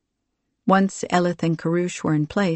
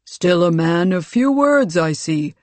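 raised, commanding voice easing off at the end; booming baritone; 5 dB louder than usual. A narrator's reading. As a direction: open with a mild boom. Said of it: "his voice a booming baritone".

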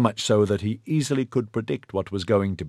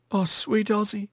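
shocked gasp; 12 semitones higher, 4 dB quieter than usual. A narrator's reading. Corilin in shock.

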